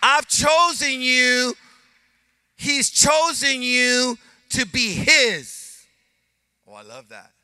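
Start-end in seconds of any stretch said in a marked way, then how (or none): 0.0-5.9 high, loud and slow; God's words shouted to the church; emphatic declaration. Acted. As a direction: hammer it home. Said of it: "look what he says".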